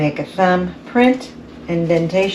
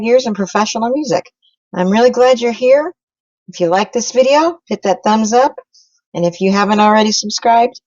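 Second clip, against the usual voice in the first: announcer voice; 3 semitones higher than usual. Acted. Channel intro and subscribe pitch.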